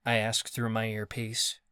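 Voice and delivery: ordinary delivery, nothing marked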